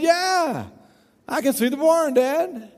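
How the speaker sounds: high, drawn-out reply to Dad; imitated calling out. Farmer's son tells his father he finally sees it, imitated calling. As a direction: play a son calling from far across the yard.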